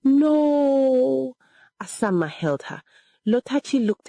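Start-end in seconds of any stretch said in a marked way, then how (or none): 0.0-1.4 long, high wail of grief; loud crying out. A narrator's reading. Daughter hears her mother will die, loud wail. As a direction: wail it loudly, drawn out. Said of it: "began to cry loudly".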